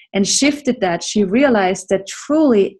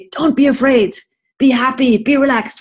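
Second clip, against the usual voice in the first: mock soothing self-talk; 5 semitones higher, 3 dB louder than usual. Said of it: "when you're nervous and afraid".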